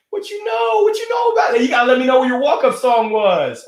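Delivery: very high, loud excited shout; loud playful hype; hyped. Real, not acted. Excited reaction to walk-up songs.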